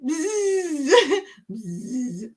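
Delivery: buzzing bee imitation; drawn-out playful bzzz; animal noise practice. Shown as a demonstration. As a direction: buzz like a bee, breaking off. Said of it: "practice animal noises".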